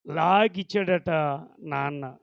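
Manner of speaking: usual speaking voice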